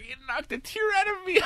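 put-on very high, strained tearful kid voice; imitated little boy's whimper; mock crying child. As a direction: squeak it out through pretend tears. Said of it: "he's trying not to cry".

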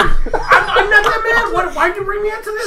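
high, loud squeaky voice through laughter; mocking wife. Husband mimicking his wife dodging the argument, laughing. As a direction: play her as giggly and helpless. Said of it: "That's what she's telling me".

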